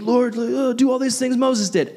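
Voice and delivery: high pleading whine; whiny crowd. Retold or imitated. Pastor voicing the crowd begging Jesus: high whiny plea. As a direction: beg like a demanding crowd. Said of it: "He just keeps saying".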